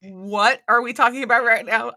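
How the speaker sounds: loud, high disbelief; mock incredulous. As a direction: react aghast to a sudden proposal. Imagined baffled reply to a proposal.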